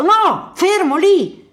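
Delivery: high, loud stop command; terrified high shout to a child; panicked shout. Acted. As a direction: yell at the kid to freeze. Man on a mine warns child.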